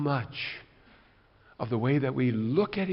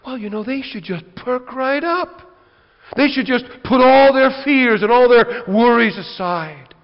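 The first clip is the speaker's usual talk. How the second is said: louder and higher, brushing off their worries; dismissive, loud, higher-pitched preacher; mock impatient pastor; 9 semitones higher, 14 dB louder than usual. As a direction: wave their fears away like it's easy. Pastor voicing his own impatience.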